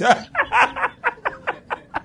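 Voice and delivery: long high carefree laugh; demo laugh. Shown as a demonstration. Pastor acting out laughing when dismissed, long laugh. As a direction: laugh it off freely. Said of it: "Say, "Okay, am I going?".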